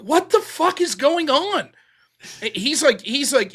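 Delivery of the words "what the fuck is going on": higher, slow, incredulous retelling; mock bewilderment. Retelling a buddy's reaction to the scandal, bewildered. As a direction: imitate a buddy's drawn-out disbelief.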